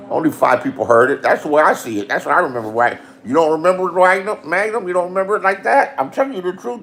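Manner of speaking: ordinary talk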